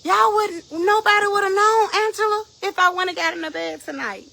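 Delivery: high, loud shout easing at the end; emphatic outcry. Real, not acted. Preacher admitting to listeners nobody would have known, shouted. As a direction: cry it out as a heated confession.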